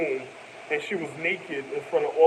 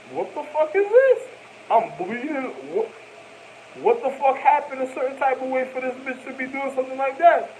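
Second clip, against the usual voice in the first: alarmed disbelief; 9 semitones higher than usual. Retold or imitated. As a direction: recoil at the sight of your own blood. Waking to find wrists cut.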